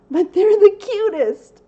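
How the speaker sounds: gushing about how cute puppies are. Real, not acted. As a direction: squeal it over the puppies.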